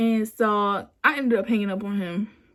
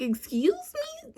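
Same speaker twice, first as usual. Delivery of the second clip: offended disbelief; 4 dB quieter than usual. Retold or imitated. Retold offended reaction to being dropped.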